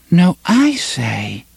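put-on high, slow sulky child voice; petulant little girl. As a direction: pout like a small girl. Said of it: "A faintly petulant voice of a little girl".

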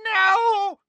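very high, wailing scream; anguished shriek. A narrator's reading. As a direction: scream it out in denial. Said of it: "she screamed".

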